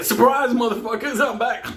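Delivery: high, loud gleeful ambush; returning monster dog. Retold or imitated. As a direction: burst in laughing as the comeback villain. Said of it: "this monster is gonna come up like".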